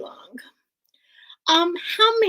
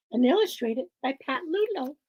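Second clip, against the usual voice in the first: ordinary delivery, nothing marked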